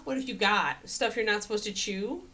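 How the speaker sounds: usual speaking voice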